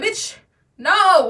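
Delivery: loud high indignant no. Real, not acted. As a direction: shout the no in protest.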